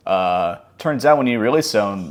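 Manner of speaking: normal talking voice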